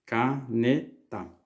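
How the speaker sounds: "Caneta" slowly split into ca, ne, ta; syllable by syllable. Shown as a demonstration. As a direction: say "Caneta" with a short break after each syllable. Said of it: "separando as sílabas".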